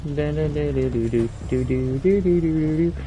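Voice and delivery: ordinary talk, nothing marked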